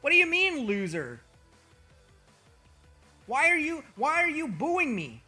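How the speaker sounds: usual speaking voice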